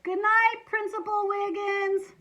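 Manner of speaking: high, loud, drawn-out call; group yell. A narrator's reading. Pupils yelling up to the principal on the roof, loud call. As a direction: holler it up to the roof. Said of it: "We all yelled".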